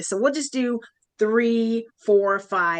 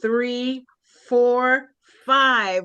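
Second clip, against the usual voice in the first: loud counting; 3 semitones higher, 3 dB louder, much slower than usual. Shown as a demonstration. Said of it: "most loudest, powerful push".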